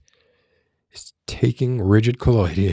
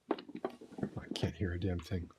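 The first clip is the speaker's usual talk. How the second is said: whisper; 2 semitones lower, 18 dB quieter than usual. Real, not acted. Whispering off-mic.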